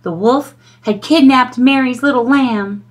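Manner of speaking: plain reading voice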